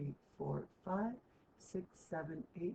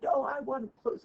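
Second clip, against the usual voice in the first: higher and louder, protective dog; a dog refusing to share, raised and high; higher, louder dog guarding the bones; stubborn dog voice; 8 semitones higher, 8 dB louder than usual. Retold or imitated. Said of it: "Zuba's like".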